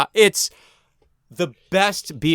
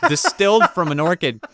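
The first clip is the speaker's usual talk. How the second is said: normal talking voice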